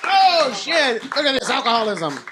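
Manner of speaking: high, hyped-up stage call; host introduction. Real, not acted. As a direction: call the next comic up to the stage. Host bringing next comic on stage.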